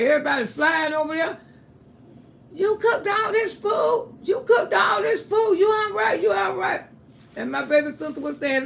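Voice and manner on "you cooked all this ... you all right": high, loud, tearful astonished shouts; imitating family crying out in disbelief; amazed relatives. Relatives seeing the sick mother cooking, loud high tearful shouts.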